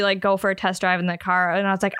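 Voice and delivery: ordinary talk, nothing marked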